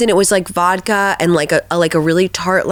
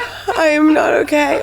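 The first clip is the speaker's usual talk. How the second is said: breathless cry-laugh, higher; laughing hard; 6 semitones higher than usual. Real, not acted. Host hearing the customs story, helpless laughter. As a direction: crack up until you can barely speak.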